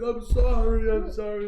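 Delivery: loud, high mock-tearful apology; crying Riley. Friend apologizing after the runaway car, high mock-crying wail. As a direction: imitate a wailing apology. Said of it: "Riley's, like, crying, and she's like".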